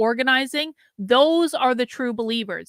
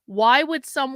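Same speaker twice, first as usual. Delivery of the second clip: normal talking voice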